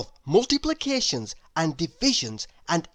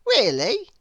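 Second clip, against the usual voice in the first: put-on much higher little boy voice; bright childlike schoolboy voice; young William; 5 dB louder than usual. A narrator's reading. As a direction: pitch it up like an eager small boy. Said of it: "said William".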